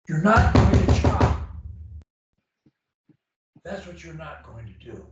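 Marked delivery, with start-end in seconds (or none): 0.1-1.4 loud, high, firm prohibition; emphatic coach laying down the rule; forceful command. Real, not acted. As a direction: belt it out firmly, no argument. Coach forcefully forbids choppy speech.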